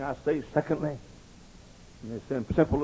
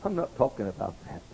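normal talking voice

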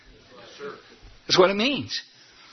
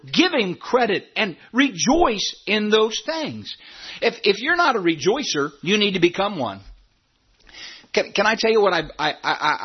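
usual speaking voice

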